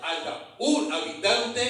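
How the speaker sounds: usual speaking voice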